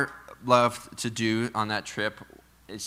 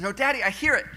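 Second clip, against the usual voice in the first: higher, lightly voiced four-year-old; little girl's voice; 10 semitones higher, faster than usual. Dad voicing his daughter on his lap, lightly high. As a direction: imitate a small child insisting. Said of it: "And she goes".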